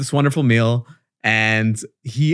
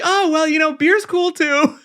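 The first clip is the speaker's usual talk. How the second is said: high, strained voice, slowing at the end; squeaky nervous dodge; 13 semitones higher than usual. Retold or imitated. Dodging the pricey wine, squeaky and strained. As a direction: squeak it out, trying to wriggle out of the bill. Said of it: "I'm like".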